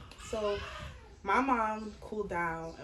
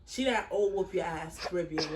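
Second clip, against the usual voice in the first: ordinary talk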